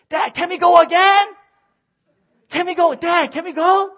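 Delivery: playful imitation of his kids; mock begging kid. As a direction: beg like an eager kid.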